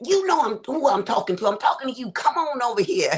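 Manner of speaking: high, put-on grandma voice, half laughing; frustrated grandma. Grandma fed up with mixing up grandkids' names, high mock-stern call. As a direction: play the grandma calling a kid over, lightly mocking. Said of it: "She said".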